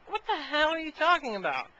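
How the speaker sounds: high-pitched, baffled reenactment; incredulous retort. Retold or imitated. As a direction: act out being totally thrown. Retells baffled reply to odd request.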